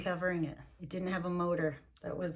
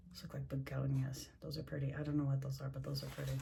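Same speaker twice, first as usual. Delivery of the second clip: hushed aside; 3 semitones lower, 7 dB quieter, faster than usual. Real, not acted.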